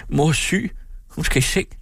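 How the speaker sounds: mildly tearful, high-pitched plea; tearful cry. A narrator's reading. Daughter urges that mother be put to bed, tearful. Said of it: "råbte Karen grædende".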